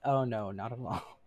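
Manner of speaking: normal talking voice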